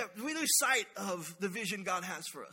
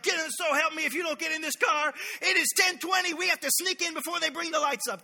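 put-on high, loud, frantic yell at the kids; hammed-up parent screaming to hurry them; shouting mom late for church; mock screaming at kids; 6 semitones higher, 5 dB louder than usual. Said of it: "screaming at the kids".

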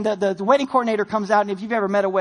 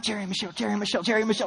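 rapid memorizing; 3 semitones lower, 7 dB quieter, much faster than usual. Retold or imitated.

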